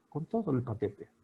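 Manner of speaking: ordinary delivery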